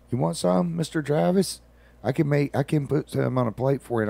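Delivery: put-on high, sweet child's voice; little girl voice. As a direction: voice a cute little girl offering food.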